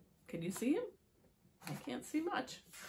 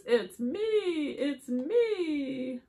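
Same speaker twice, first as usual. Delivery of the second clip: higher, drawn-out reveal; excited horse; 7 semitones higher, 8 dB louder, much slower than usual. Acted. Horse reveals itself under the mask, excited cry. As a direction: call it out gleefully as a horse. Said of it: "says the horse".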